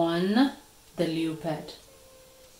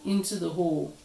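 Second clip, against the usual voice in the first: ordinary delivery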